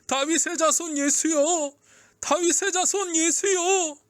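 high, drawn-out call to Jesus; crying out. A narrator's reading. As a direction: call out slowly over a crowd. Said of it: "향해 외쳤어요". (목청껏 외치는 소리)

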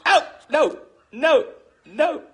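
high, loud no no no; loud pleading. Real, not acted. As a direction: beg loudly, high-pitched. Begging not to stop.

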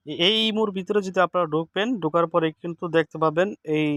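normal talking voice

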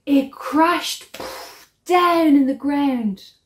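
loud and high after a gasp; dramatic crash. A narrator's reading. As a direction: burst out with the crash. Dramatic story climax.

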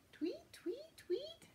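high breathy whispered tweets; whispered bird chirps. Acted. Bird chirps in kids' song.

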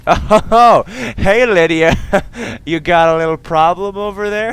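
high, loud laughing mockery; laughing jeer at the stuck companion; gloating taunt. Real, not acted. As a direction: rub it in with a cackle.

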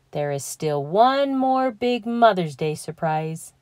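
plain reading voice

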